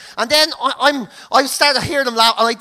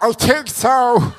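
slow, strained little answer; a hint of a winded man struggling; mock weakness; slower than usual. Winded boxer answering the medic, mildly hammed-up weakness.

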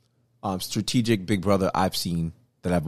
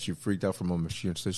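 ordinary delivery

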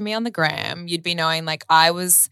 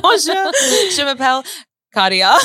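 high, laughing "Bonjour" sliding into the name; mock French greeting; 9 semitones higher, 4 dB louder than usual. Answering her co-host's teasing with a laughing French greeting. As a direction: play a giggly French learner. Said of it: "I love when you're saying it".

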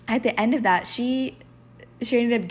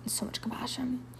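soft breathy echo; whisper; 4 semitones higher, 8 dB quieter than usual. Real, not acted. Host stressing compassion to her viewers, whispered. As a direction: let it fall to a whisper. Echoes her point tenderly.

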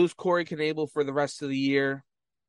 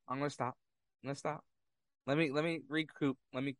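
much softer, pulling back; calming himself down; 10 dB quieter than usual. Real, not acted. Vlogger cooling off after ranting at Mets fans, much softer. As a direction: rein it in, almost under breath. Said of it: "I'm getting a little heated".

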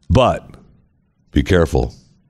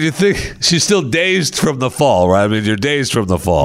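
ordinary delivery

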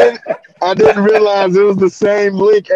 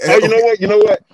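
ordinary talk

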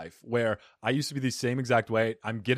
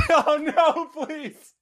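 high-pitched laughing "oh no"; squealed, embarrassed begging; laughing plea; 6 dB louder, much slower than usual. Real, not acted. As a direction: plead through laughter, loud then fading. Teased about telling on her, pleads.

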